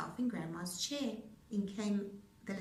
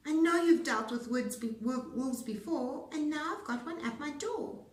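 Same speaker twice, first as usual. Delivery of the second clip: pig cries; 6 semitones higher, 5 dB louder than usual. A narrator's reading. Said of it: "Pig cried".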